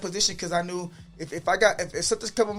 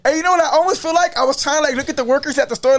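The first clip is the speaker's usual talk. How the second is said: excited; 9 semitones higher, 8 dB louder than usual. Real, not acted. Excited retelling.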